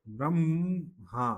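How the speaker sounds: Brahma stretched out slowly with a falling end; teacher slowly modelling Brahma; slow and drawn out. Shown as a demonstration. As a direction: draw out Brahma carefully. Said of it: "बोलते हैं".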